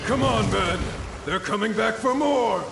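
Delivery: high, ringing call to arms; rally the troops over the fighting; battle rally. Acted. Soldier rallying his men against the returning enemy, ringing battle cry.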